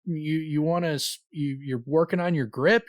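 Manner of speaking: high, halting puzzled guessing; mock cluelessness. He misreads her touch as grip training, said in high, baffled mock innocence. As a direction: fumble for an innocent explanation. Acts out his clueless reply.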